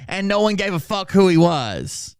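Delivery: ordinary delivery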